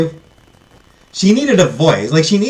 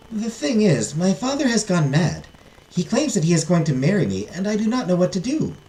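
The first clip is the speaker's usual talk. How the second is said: higher voice of a troubled heiress; distressed princess; 6 semitones higher, 3 dB quieter than usual. Acted. Heiress telling the fairy about her father, distressed princess voice. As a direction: princess confiding her predicament, pitched up.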